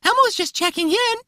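high squeaky Elmo voice; chirpy furry monster checking in. Retold or imitated. Voicing Elmo again.

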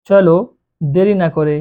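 ordinary delivery

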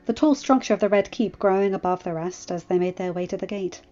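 ordinary delivery, nothing marked

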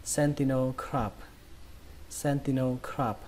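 sentinel crab as SEN-ti-no krahb; T without a puff in sentinel, open ah in crab; unaspirated T. Shown as a demonstration. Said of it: "đọc âm thì này thành âm tì".

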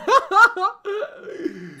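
loud, high burst of laughter. Real, not acted. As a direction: crack up laughing. Laughs after saying yes sir. (carcajada aguda y fuerte)

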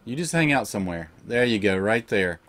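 tuck the pet in gently; tender, soothing. Real, not acted. Gamer settling his pet down, soft and tender.

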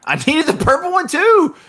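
high mock-whiny complaint; mock whine. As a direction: play the hard-done-by loser. Mock-whiny protest in game.

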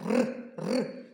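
«Р, р» as two separate trills; consonant demonstration. Shown as a demonstration. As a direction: trill «Р» twice, each on its own. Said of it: "Що нам заважає його вимовляти?". (два окремі розкотисті «р»)

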